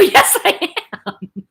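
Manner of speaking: slow, high, laughing admission. Retold or imitated. Daughter cheerfully defying her angry dad, laughing. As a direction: drag it out through laughter.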